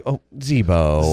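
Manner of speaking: a hint of slow, low adoring sigh; reverent sigh. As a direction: breathe his name like a devoted fan. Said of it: "speak of him with the reverence".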